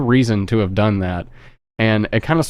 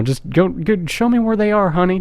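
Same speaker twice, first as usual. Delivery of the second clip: put-on high coaxing old-lady voice, much higher; sweet old lady; 8 semitones higher than usual. Killer soothing the frightened girl, high and sweet. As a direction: coax her along like a sweet granny. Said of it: "You know".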